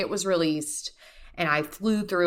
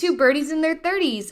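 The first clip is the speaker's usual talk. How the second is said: "Two birdies in their thirties" echoed much higher and brighter; amused echo; 10 semitones higher, 4 dB louder than usual. Real, not acted. Cohost echoing her partner's phrase, amused and high. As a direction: bounce the phrase back, delighted.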